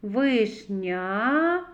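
Вишня ending on a long rising А; drawn-out final А. Shown as a demonstration. Teacher picks out the last vowel, А drawn out. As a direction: stretch the end of Вишня. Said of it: "а голосний".

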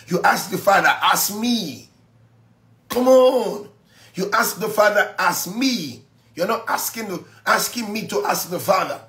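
high, driving sermon push; fired-up preaching. Acted. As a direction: urge the congregation on.

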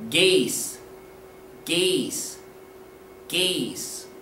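"geese" said as "gace", hard g; spaced, falling "geese" with an ay vowel; model pronunciation. Shown as a demonstration. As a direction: say "geese" carefully, rhyming with "face". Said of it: "The correct pronunciation is".

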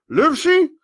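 high, loud excited call to Livesey; eager squire calling. Acted. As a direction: call out Livesey's name, thrilled. Squire calls the doctor.

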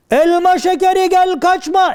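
high, slow, drawn-out holler; chasing shout. Acted. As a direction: call the runaway from afar. Kovalayan uzaktan bağırıyor. (uzaktan tiz sesle bağırma)

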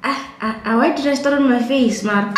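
normal talking voice

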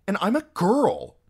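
put-on high, loud indignant girl; indignant retort. A narrator's reading. Arya corrects the armed singer.

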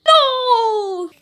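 high, loud desperate cry. Pretend. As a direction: cry out in despair. Pleading not to be ejected. (grito desesperado)

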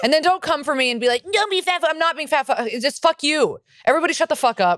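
high, heated ranting; riled up. Real, not acted. Comic warning listeners not to come for her, riled and high-pitched. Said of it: "Honk's riled right now".

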